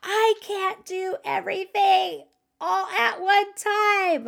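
re-enacted mom meltdown yell; exasperated yell. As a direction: snap at everyone at the end of your rope. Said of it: "I just yelled".

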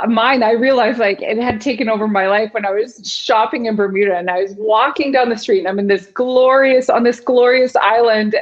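ordinary talk, nothing marked

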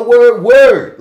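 sit loudly in the vowel of "word"; "word" high, loud and drawn out; stretched vowel. Shown as a demonstration. Showing how New Orleanians say "word", vowel stretched. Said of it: "we'll sit in them".

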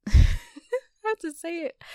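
laughing through the admission; laughing confession. Real, not acted. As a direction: giggle it out slowly.

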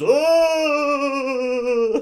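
overacted groan on waking; hammy stage actor. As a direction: ham it up like a stage vampire. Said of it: "we've talked about overacting".

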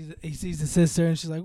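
usual speaking voice

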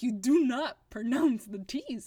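"you do not pronounce the T's" hit loudly word by word; emphatic correction. Real, not acted.

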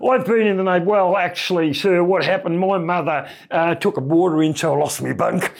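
a daft overeager sailor greeting an officer; the silly voice. Retold or imitated. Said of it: "do the voice on him".

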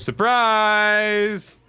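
high, drawn-out "surprise"; hammed-up party surprise shout; gleeful surprise call. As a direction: sing out a big reveal. Acted character springing a surprise.

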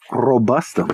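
trilled R in "robustum"; rolled R. Shown as a demonstration. Co-host showing off a Latin spider name, rolled R. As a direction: roll the R at the start of "robustum". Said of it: "do you have to roll the R".

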